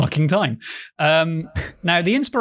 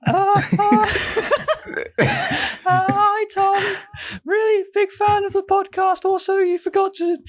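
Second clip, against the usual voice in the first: very high-pitched mock impression; hammed-up falsetto partner, sweetly nagging; Tash. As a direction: put on a squeaky falsetto voice. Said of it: "do an impression of Tash".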